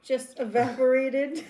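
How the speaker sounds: slow, loud, drawn-out quote; slow, loud imitation of her dad; mock dad line. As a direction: stretch it out like Dad's excuse. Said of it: "My dad always says that wine".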